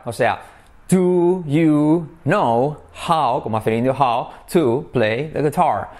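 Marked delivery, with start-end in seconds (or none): 0.9-3.4 very slow, separated "Do you know how"; deliberate teacher's enunciation; word-by-word model. Shown as a demonstration. Word-by-word model, then connected take. 3.9-5.9 slow "how to play the guitar"; deliberate teacher's enunciation; word-by-word model. Shown as a demonstration.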